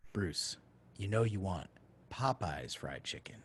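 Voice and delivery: calm and collected, with long deliberate pauses; calm inner voice. Retold or imitated. A mysterious voice fills broke Bruce from within, calm and collected. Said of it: "like a calm, collected, perennial voice".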